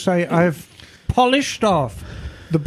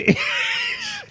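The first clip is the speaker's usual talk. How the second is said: burst of laughter; 4 dB quieter than usual. Real, not acted. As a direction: squeal with laughter. Laughs over the candy.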